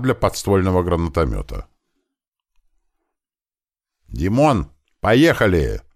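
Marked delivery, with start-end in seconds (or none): none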